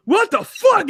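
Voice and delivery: squeaky imitation of the host; high-pitched imitation. Imitating the host's high voice when he's startled. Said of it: "you get so high when you like".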